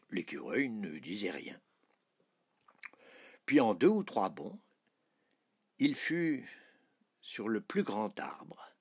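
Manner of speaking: ordinary delivery, nothing marked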